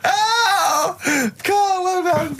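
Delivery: high, sobbing mock praise; mock crying. Real, not acted. Host teasing Carl after his film: high mock sobbing. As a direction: pretend to weep with emotion, high.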